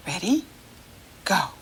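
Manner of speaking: ordinary talk, nothing marked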